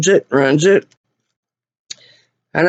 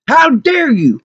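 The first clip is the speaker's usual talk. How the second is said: higher, affronted reproach; indignant; 4 semitones higher, slower than usual. Real, not acted. Wronged customer to the garage workers, indignant. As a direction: scold them in outrage. Outraged customer, first take.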